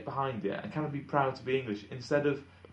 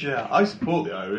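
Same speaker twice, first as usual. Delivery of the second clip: mock fan voice; 6 semitones higher, 5 dB louder than usual. Mocking Irish fans.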